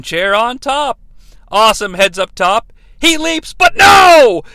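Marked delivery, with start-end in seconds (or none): 3.0-4.4 high call building to a big yell; dramatic announcer shout. Acted. Recapper calling the missed leap, dramatic shout. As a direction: shout the sudden twist.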